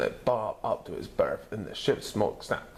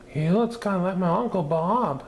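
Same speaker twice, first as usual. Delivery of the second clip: higher, brighter mother voice; amused mother; 10 semitones higher, 4 dB louder than usual. A narrator's reading. Mother comparing the dinosaur to her uncle, lightly voiced. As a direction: chirp it out like a cheerful mom. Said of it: "said Miss Lizardo".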